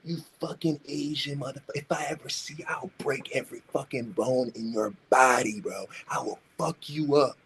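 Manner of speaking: hushed sneering thug voice; bully's texts, in a hushed sneering voice; mock thug threat. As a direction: read the texts like a menacing thug.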